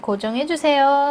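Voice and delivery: normal talking voice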